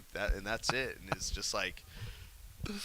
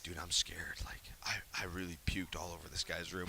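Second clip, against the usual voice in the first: scared whisper; 5 semitones lower, 8 dB quieter than usual. Retold or imitated. Confessing to friends, scared.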